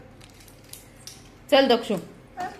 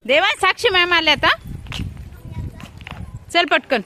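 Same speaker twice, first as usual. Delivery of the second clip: urgent hurry; 7 semitones higher, 5 dB louder than usual. Real, not acted. Mother hurries child, teacher arriving.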